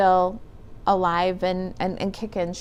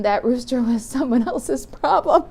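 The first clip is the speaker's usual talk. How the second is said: higher-pitched, laughing through the words; 5 semitones higher than usual. Real, not acted. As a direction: let a real chuckle break into the line. Laughing at her own joke.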